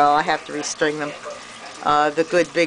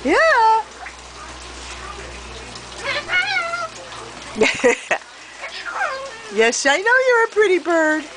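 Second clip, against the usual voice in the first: much higher, sing-song voice for a pet bird; pet talk. Real, not acted. Owner cooing to her pet parrots.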